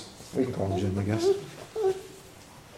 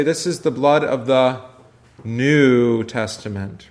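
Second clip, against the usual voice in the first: New Testament slowed and weighted, "New" leaned on; slow down and land hard on "New Testament"; stress on NEW; slower than usual. Shown as a demonstration. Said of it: "put your emphasis on a little different word".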